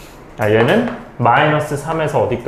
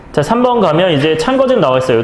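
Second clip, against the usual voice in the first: normal talking voice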